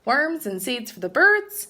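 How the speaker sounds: usual speaking voice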